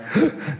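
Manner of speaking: sharp gasp, breath knocked out; high strangled gasp for breath; winded gasp. Retold or imitated. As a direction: suck in air like you just got winded. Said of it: "And I'm like".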